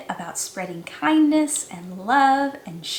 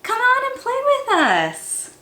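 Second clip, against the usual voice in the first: playful fish friends; 12 semitones higher, 3 dB louder than usual. A narrator's reading.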